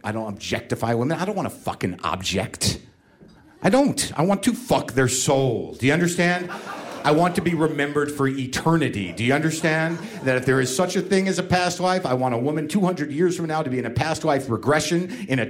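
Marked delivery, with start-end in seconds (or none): none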